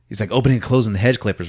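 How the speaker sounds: usual speaking voice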